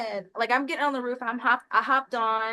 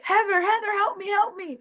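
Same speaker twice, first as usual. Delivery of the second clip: high, whiny mock wail for help; mock desperate scream; 9 semitones higher than usual. Stuck man screaming to his wife, mock desperate wail. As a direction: wail for your wife like a scared kid. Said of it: "screaming at his wife".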